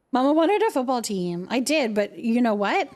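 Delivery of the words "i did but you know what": usual speaking voice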